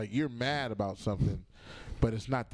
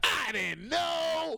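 very high, stretched-out comic cry; mock innocent shout; much slower than usual. Comedian shows how he disarms an officer, high mock-innocent shout. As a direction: protest innocence in a slow, pitched-up yell.

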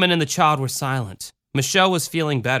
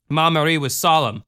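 usual speaking voice

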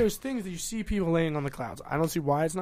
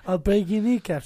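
lightly voiced gangster, slow and higher; slow, louder mock mobster threat; 4 semitones higher, 6 dB louder than usual. Friend demonstrates how gangster bikers talk, mock gangster threat. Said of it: "how do they talk?".

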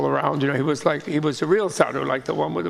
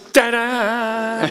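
high, louder showman's ta-da; triumphant ta-da; 6 semitones higher, 5 dB louder than usual. Retold or imitated. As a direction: jump out and present yourself proudly. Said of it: "And he goes".

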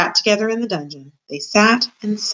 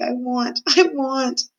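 desperate, pitched-up longing; sobbing; 6 semitones higher, slower than usual. A narrator's reading. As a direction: plead helplessly through an acted sob. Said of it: "sobbed Mig".

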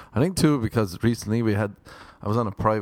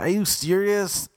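high put-on indignant voice; imitating outraged seatmate; 10 semitones higher than usual. Seatmate protesting about the armrest, high mocking voice. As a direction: squeak it out like the offended passenger. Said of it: "He's like".

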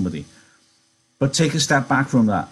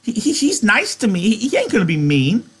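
high-pitched belligerent protest; high, stammering defiance; 7 semitones higher than usual. A narrator's reading. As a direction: argue back hotly in a high voice. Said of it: "Lenny retorted belligerently".

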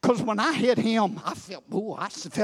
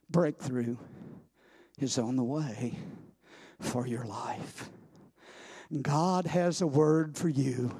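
hushed prophecy; 7 semitones lower, 6 dB quieter than usual. Real, not acted. Preacher promising the congregation, hushed. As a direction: speak it softly like a promise. Quiet intense prophetic close.

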